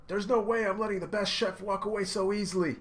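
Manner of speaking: high, loud emotional yelling; impassioned shouting. Retold or imitated. Luffy yelling that Sanji is lying. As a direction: call him out, all heart.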